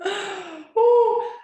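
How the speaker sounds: sharp gasp into a loud, high "ooh"; gasp, then exhale on a high, loud ooh; vulnerable gasp. Real, not acted. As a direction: let out a big, nervous "whoo". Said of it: "This is vulnerable".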